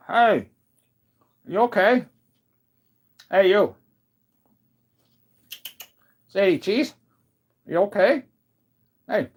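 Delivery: high, loud calling to the dog. Real, not acted. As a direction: call out to a dog that won't wake.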